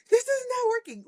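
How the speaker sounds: very high mock whine of frustration; frustrated wail. Coach showing it's okay to cry, frustrated. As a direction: wail it like a kid about to cry. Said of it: "okay to be frustrated and just cry".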